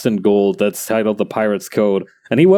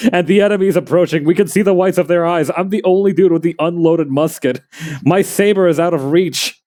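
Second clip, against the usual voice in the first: higher-pitched, urgent mock battlefield narration; beleaguered Civil War soldier; 7 semitones higher than usual. Soldier with an unloaded musket as the enemy approaches, mock desperation. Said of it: "cosplaying a Civil War soldier, furiously trying".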